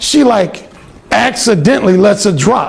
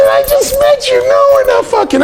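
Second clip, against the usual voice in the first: much higher mock woman's voice; indignant roommate. Alan imitating the roommate turning him down, very high. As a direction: squeal the refusal.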